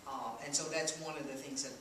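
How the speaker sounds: ordinary delivery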